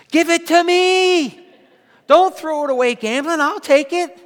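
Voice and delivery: high, loud mock grab for the money; greedy joke. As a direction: play the greedy pastor, arms out. Pastor jokes about casino money.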